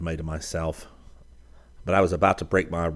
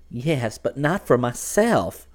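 put-on slow, higher young woman's voice, hesitant; Tessie's voice; 7 semitones higher, 4 dB louder, slower than usual. A narrator's reading. Tessie confessing her fear for him, slow and high. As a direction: hold back, then let it out slowly.

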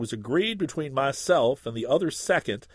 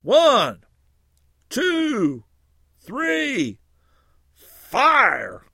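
high, loud called count; a second calling the duel's signal through fog; shouted countdown; 9 semitones higher, 6 dB louder, much slower than usual. A narrator's reading. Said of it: "I immediately shouted".

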